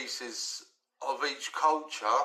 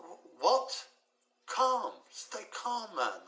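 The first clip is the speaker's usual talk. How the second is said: slow, stretched mock repeat of his slang, pitched higher; incredulous echo; 6 semitones higher, much slower than usual. Dad echoing his son's slang, slow and incredulous. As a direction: drag out each word in disbelief. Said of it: "I was like".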